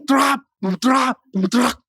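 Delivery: loud percussive "drap" bursts; imitated clatter. Preacher mimicking early-morning kitchen noise, imitated clatter. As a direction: mimic pots banging in the kitchen. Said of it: "Bunyi-bunyi".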